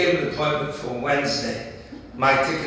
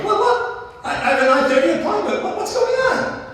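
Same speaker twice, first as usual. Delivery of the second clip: much higher, frantic squeaky protest; retold hollering in a much higher voice with a laugh; mock hollering. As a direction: squeal it out like a panicked man. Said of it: "the man starts hollering".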